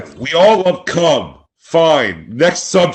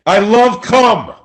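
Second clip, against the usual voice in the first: mock yelling; 6 semitones higher than usual. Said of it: "but why you're yelling it at us".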